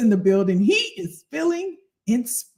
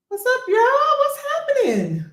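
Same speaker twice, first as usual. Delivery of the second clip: excited greeting; 11 semitones higher, 3 dB louder than usual. Real, not acted. Greeting viewers.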